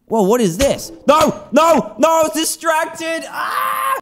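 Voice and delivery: high panicked yelling; shocked shout. Real, not acted. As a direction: caught off guard, cry out. Caught by seeker suddenly.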